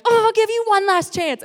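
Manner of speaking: high, exasperated acted-out cry; retold exasperation. Angry believer grants God a last chance, mock exasperated wail.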